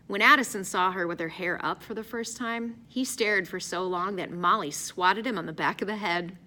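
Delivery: plain reading voice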